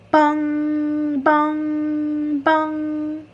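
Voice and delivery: high, slow bong bong bong; clock chimes. A narrator's reading. The clock striking ten at the party, high slow tolling. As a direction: toll like an old clock.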